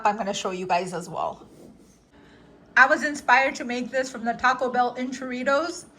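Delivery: usual speaking voice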